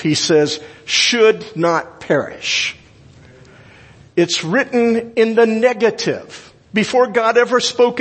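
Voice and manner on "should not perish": slow stress. Shown as a demonstration. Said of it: "Look at all the negatives".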